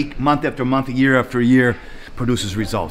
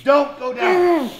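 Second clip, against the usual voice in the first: shouting coach; 8 semitones higher, slower than usual. Real, not acted. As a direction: drive them hard with yells. Trainer yelling during a set.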